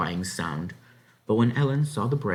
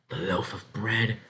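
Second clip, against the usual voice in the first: usual speaking voice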